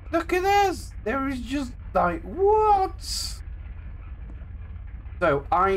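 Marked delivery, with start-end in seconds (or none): 0.1-0.9 surprised. Real, not acted. Amazed at the car's state. 1.0-3.0 baffled, shocked exclamation; surprised. Real, not acted. Amazed at the car's state.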